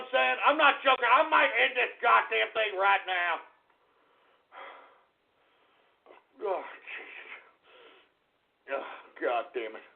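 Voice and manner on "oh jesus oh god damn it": exasperated sigh. Real, not acted.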